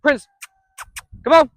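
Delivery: ordinary talk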